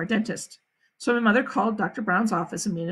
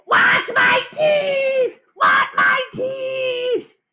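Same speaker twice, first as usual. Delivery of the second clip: high, drawn-out tantrum scream; toddler screaming; 14 semitones higher, 4 dB louder, much slower than usual. A narrator's reading. Little brother demanding his teeth back, screaming tantrum. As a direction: scream it slowly, each word stretched. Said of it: "Fudge started to scream".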